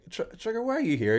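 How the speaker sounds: high, startled Rodney voice; a high-pitched double take; surprised Rodney. Retold or imitated. Rodney voiced in retold scene.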